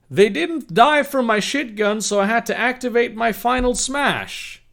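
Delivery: high-pitched, animated read-aloud; story narration. Acted.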